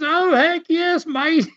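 put-on a cheerful Aussie confirming the danger, Australian accent. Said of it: "the Aussie".

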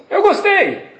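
put-on eager boy. Boy repeats compliment to rabbi.

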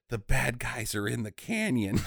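hushed, low mock-serious report; serious whisper. Henchman reporting to his boss, hushed and serious. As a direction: lean in with a soft, grave warning. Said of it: "seriously whispers in his ears and says".

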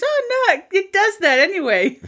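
high, squeaky fake innocence; guilty boyfriend voice. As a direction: play the caught liar. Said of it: "He goes".

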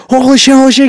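high-pitched mock excitement; imitating a thrilled, panicky new dad; frantic retold amazement. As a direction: gush it like a husband rushing in. Husband rushes in, retold.

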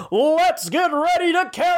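mock ring announcer. Host kicking off a carol contest, mock ring announcer. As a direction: hype the crowd like a boxing announcer.

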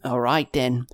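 put-on whispered old sailor. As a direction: hush it like a stage aside. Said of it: "is going to just be like".

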